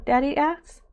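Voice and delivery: usual speaking voice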